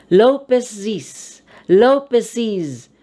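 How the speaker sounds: "Lopezes" ending in a clear -iz; "Lopezes" said LO-pe-siz. Shown as a demonstration. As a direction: sound out the added syllable of "Lopezes". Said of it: "The E S is pronounced as".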